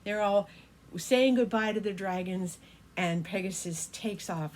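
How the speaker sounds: usual speaking voice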